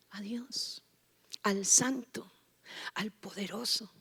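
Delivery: reverent whisper. Acted. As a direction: hush the name of God. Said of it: "susurro en la prédica". (susurro reverente)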